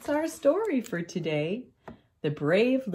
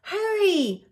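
high, urgent little fox shout; high-pitched hurrying yell; 11 semitones higher than usual. A narrator's reading. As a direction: urge a friend on, pitched way up. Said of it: "shouted Little Fox".

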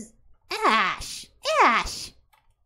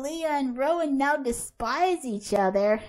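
ordinary talk

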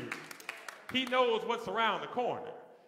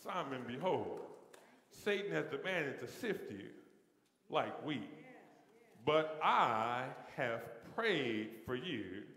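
soft, slow and much lower, each phrase left hanging; hushed scripture reading; 7 semitones lower, 6 dB quieter, slower than usual. Acted. Preacher softly rereads the verse to the congregation, hushed. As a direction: read it gently, like a secret promise. Quiet reverent rereading of text.

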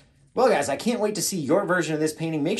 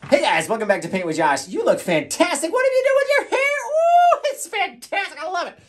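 hammed-up greeting soaring into a squeal; over-the-top gushing; 9 dB louder than usual. Host marvelling at the viewer's hair, squealing hammed-up praise. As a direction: go higher and higher with mock adoration.

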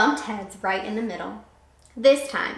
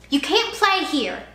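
slow mock yell of a bully; 5 semitones higher, 4 dB louder than usual. A narrator's reading. Sammy warns Kevin off, mock bully yell. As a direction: bark it like a bossy kid. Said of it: "Sammy yelled".

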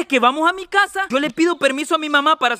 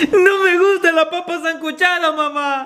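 tearful whine; 2 semitones higher than usual. Acted. Child refuses ejotes to mamá, whining tearfully.

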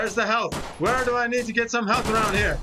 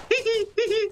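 Homer Simpson giggle; 12 semitones higher, faster than usual. Retold or imitated. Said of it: "As the Homer Simpson says".

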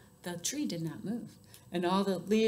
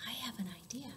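much softer, breath-light parent voice; almost whisper it, tenderly; gentle; 12 dB quieter, faster than usual. A narrator's reading. Elephant's parent offering help, gently and softly. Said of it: "said gently".

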